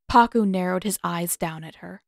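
ordinary delivery, nothing marked

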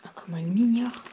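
whispered aside about the cat; whispering. Real, not acted. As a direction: whisper it to yourself, amused. Cat ignores her calls.

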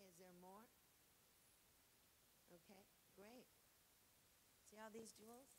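a lightly voiced, soft and timid servant asking; polite servant. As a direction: ask humbly, almost apologetically.

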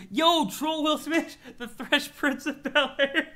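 high, loud, drawn-out hype with laughter; excited outburst. Real, not acted. Streamer spots the Troll Will Smith parody, excited outburst. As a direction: shout it out, delighted and cracking up.

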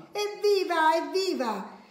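put-on high, delighted child's hooray; excited cheer. Story child cheers the tomatoes, excited high cheer. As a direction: cheer it high, falling away at the end.

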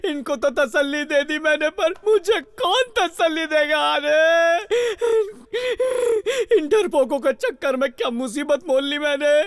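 comic sobbing self-pity; blubbering whine of a secretly scared leader; tearful lament. Acted. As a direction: wail and sob through the complaint. Leader alone, crying over his trouble.

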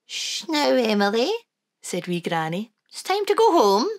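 put-on high, lilting old Scottish granny; Wee Granny voice. Granny to the children, high and fond.

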